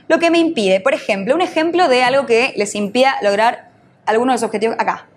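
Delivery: ordinary delivery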